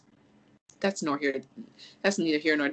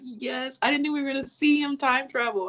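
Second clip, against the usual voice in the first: retold thrilled reaction; 9 semitones higher than usual. Re-enacts thrilled reaction to film.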